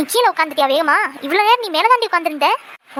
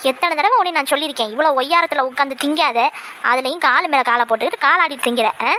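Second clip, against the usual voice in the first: ordinary delivery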